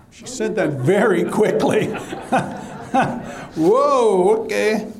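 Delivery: chuckling through the words; laughing. Real, not acted. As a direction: laugh as you tease her quick reply. Laughs at congregant's quick answer.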